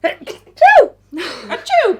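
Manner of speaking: loud high mock sneeze; fake sneeze. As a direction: burst out a playful sneeze. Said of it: "I'm gonna sneeze".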